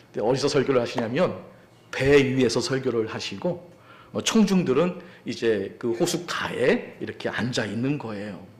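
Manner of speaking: normal talking voice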